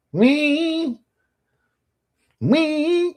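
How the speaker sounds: high sustained "wee" squeal; long high-pitched song noise; imitated squeal.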